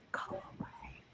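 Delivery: breathy unvoiced whisper of a ghost; ghostly whisper. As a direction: whisper it like a voice on a recording. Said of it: "it goes up to the mic".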